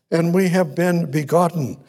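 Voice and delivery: usual speaking voice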